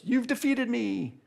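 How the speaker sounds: high, slow solemn surrender; defeated Sphinx. Acted. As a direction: yield with grand ceremony. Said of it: "And the Sphinx goes".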